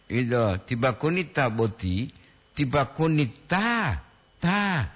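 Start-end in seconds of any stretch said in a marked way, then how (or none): 2.6-4.9 "ta" held long as a double A. Shown as a demonstration. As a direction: stretch the A in "Ta". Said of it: "Dua A".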